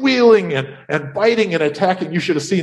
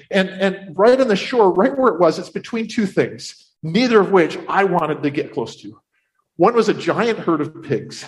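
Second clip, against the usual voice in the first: usual speaking voice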